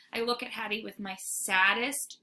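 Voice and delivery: ordinary delivery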